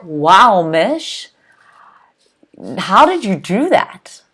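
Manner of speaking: impressed wow. Retold or imitated. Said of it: "they're like".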